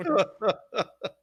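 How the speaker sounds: a high, loud burst of laughter. Real, not acted. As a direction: let a big laugh break out. Laughs at controller breaking.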